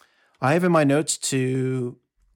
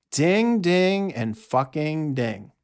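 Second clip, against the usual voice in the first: imitated bell dings; 3 semitones higher, much slower than usual. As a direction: chime it out, then drag the last ding.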